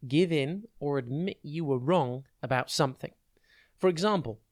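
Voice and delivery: ordinary delivery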